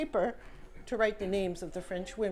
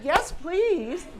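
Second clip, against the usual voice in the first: eager yes; 7 semitones higher, 7 dB louder than usual. Retold or imitated. Eager reply to meeting offer.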